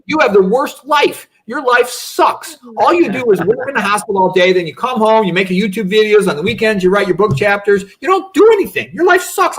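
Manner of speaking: put-on pitched well up, teasing kid; mocking son's voice. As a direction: play the kid ribbing his dad, high and bright. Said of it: "my kid said to me, he goes".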